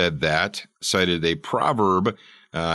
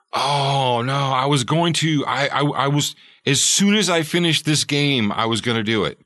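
put-on kid making excuses; 6 semitones higher, 3 dB louder than usual. Kid excuses himself to parent.

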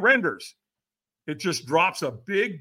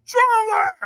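very high, loud scream; screamed demand; 7 dB louder than usual. Retold or imitated. Trainer screaming for a stronger yes. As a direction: scream the word at him. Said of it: "he screamed at me".

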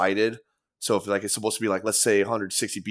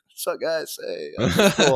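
breezy, higher, offhand hello to the audience; casual crowd greeting; 5 semitones higher than usual. Retold or imitated. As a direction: toss it out like waking from a trance onstage. Greeting the crowd mid-show.

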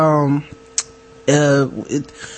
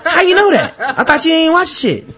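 much higher, laughing mock disbelief; mock shocked, high and laughing; incredulous callout; 10 semitones higher than usual. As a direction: catch him out, cracking up. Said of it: "You're like".

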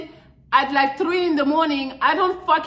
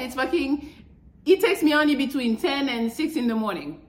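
ordinary talk

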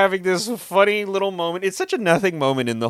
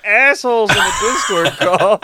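ordinary talk

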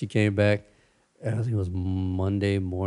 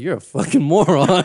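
mock insult; 7 semitones higher, 6 dB louder than usual. His reply to Sarah retold.

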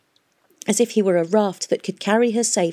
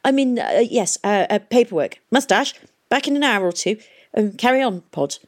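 high, halting, flustered excuse; awkward older man bumbling through fillers; embarrassed; 5 semitones higher than usual. A narrator's reading. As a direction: stammer out an excuse to get away. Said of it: "embarrassed at finding his".

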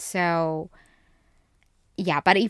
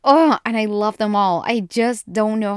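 gushing, loud and delighted; excited gush; 3 semitones higher, 9 dB louder than usual. Real, not acted. Fan gushing to viewers about the Vampire Diaries guys, loud and delighted. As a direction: burst out loud and thrilled.

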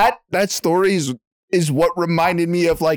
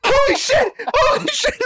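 high, laughing yell of panic; mock terrified shout. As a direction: yell it like you think you'll die. Terrified on the ride, retold.

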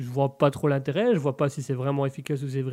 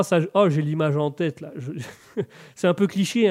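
ordinary talk, nothing marked

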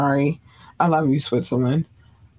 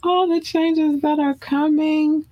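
much higher, slower, floating falsetto; drawn-out falsetto; 13 semitones higher than usual. Acted. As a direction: stretch it out high like a crooner. Repeats phrase in high drawn voice.

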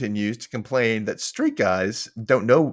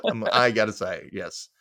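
ordinary talk, nothing marked

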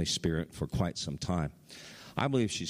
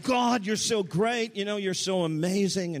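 retold rapturous praise; 13 semitones higher, 4 dB louder than usual. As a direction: praise God in a raised, louder voice, as she did. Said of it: "it was all about".

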